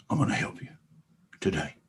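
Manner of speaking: soft whispered promise from the cow; conspiratorial whisper; hushed secret. Acted. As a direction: lean in and whisper it. Cow confides in boy.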